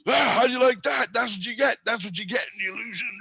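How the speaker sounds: high-pitched retold yell, easing off; mock an angry Jesus yelling; imitated yelling. Jesus yelling at the dreamer in a retold nightmare, high mock yell. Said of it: "and he's yelling at me".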